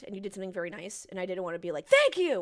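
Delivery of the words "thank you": sudden loud, yelled thanks; mock yelling. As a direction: yell your thanks as if mid-rant. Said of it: "it was like I was yelling, yelling at you".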